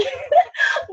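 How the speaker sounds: high laughing sob; a wet laugh that cracks upward; teary laugh. Real, not acted. Embarrassed on camera by crying, laugh-sob. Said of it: "why am I crying?".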